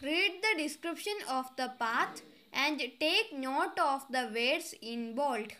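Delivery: slow, deliberate read-aloud of an English exercise; careful dictation pace; slow textbook reading. Acted. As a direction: read each word out clearly for the class. Reads instruction slowly.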